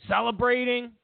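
usual speaking voice

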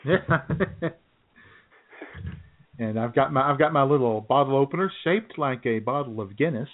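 ordinary delivery

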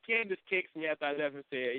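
ordinary talk, nothing marked

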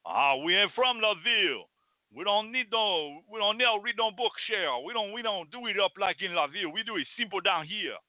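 a lightly put-on Louisiana bayou country accent; lightly mock a bayou country accent. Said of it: "like you come down from the bayou".

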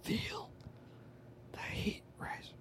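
breathy whispered line; whispering. Pretend. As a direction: whisper it like a secret. Whispered quote.

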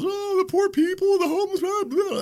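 very high, sing-song mocking pity; high mocking whine; mock bleeding heart. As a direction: whine it out like a fake do-gooder. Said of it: "there's all".

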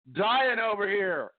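strained, hoarse from a sore throat. Real, not acted. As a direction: push through a sore throat. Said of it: "man, my throat is killing me".

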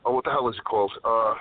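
normal talking voice

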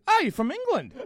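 put-on high exclamation of surprise; mock surprise. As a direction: imitate someone exclaiming over an accent. Acts out people remarking on accent.

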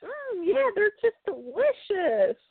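high, slow, sugary polite lie; diplomatic fake praise. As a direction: pretend to love awful food, politely. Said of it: "the diplomatic part of her".

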